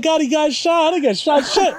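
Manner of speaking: high, laughing shout; laughing retelling. Retold or imitated. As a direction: blurt it out through laughter. Retold exchange, laughing.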